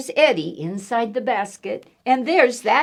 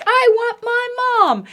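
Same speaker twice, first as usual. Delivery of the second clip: put-on wailing boy; 5 dB louder than usual. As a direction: wail for Mom. Eddie crying for mom.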